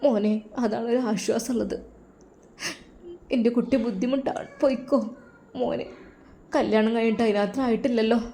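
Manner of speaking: soft, tearful mother; a mother in tears urging the student to go back to the Gulf; tearfully. Acted. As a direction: hush it out through tears. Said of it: "കണ്ണീരോടെയാണ് അത് ഷെഫി ഉസ്താദിനോട് പറഞ്ഞത്".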